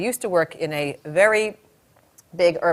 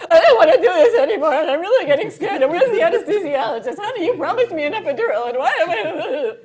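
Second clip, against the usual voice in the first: high, whimpering hammed-up panic; mock whining plea, pitched way up; panicked labouring woman; 9 semitones higher, 5 dB louder than usual. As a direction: play a scared mother near tears, high-pitched.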